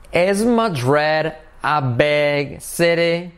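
each word drawn out slowly; slow question drill. Shown as a demonstration. Teacher drilling a question to students, slowly. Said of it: "vamos a hacerlo en pregunta".

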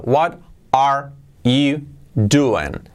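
"What are you doing?" very slow, every word separate; careful, unlinked "What are you doing?". Shown as a demonstration. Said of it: "bien pronunciado palabra a palabra".

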